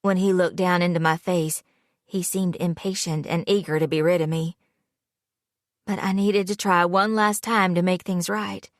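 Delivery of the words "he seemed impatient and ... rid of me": plain reading voice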